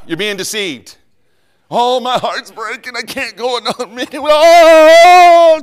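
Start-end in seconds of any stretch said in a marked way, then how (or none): 1.7-5.6 high, slow, hammed-up wail of a heartbroken teen; mocking heartbroken teen. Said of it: "I'm not mocking you, but I'm mocking you".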